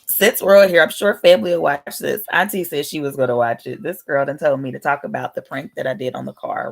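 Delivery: ordinary talk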